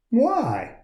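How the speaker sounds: high, puzzled question; a small puppet wondering; lightly voiced little puppet; curious Punchinella. A narrator's reading. Punchinella asks.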